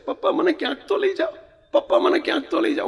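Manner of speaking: put-on high, begging child voice; pleading little boy. Little son begging his father for an outing, high pleading child voice. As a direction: beg like a small boy for an outing.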